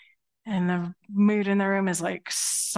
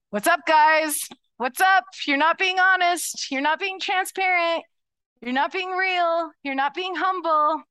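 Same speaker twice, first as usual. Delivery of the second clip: raised, higher voice calling them out; a prophet confronting leaders with the truth; Samuel confronting; 6 semitones higher, 3 dB louder than usual. Acted. Prophet Samuel confronting Saul and David, raised and higher. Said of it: "That's gonna be the voice of Samuel".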